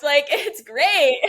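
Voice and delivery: slow words through a laugh. Real, not acted. Guest laughs to the host, amused. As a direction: let the laugh carry it. Laughing after acted reaction.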